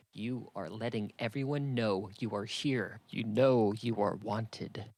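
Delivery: usual speaking voice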